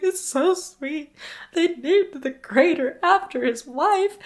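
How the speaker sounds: imitating her own crying.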